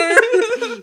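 squealing laugh; high-pitched laugh. Real, not acted. Laughing after quote.

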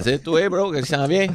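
normal talking voice

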